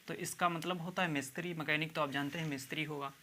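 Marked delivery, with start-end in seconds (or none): none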